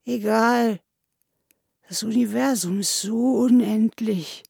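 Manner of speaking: slow, acted whine; weepy, plaintive moan; tearful lament.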